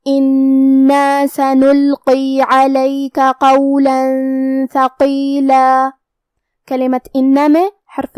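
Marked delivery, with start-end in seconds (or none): 0.1-5.9 slow, drawn-out tajweed chant; measured Quran recitation; Quranic recitation. Shown as a demonstration. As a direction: recite each letter slowly with full tajweed. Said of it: "recites verse after explaining".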